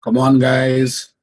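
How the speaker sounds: slow, loud call to the class; loud urging call. Real, not acted. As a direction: call out to wake them up. Teacher urging silent class.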